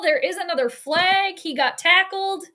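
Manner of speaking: ordinary talk, nothing marked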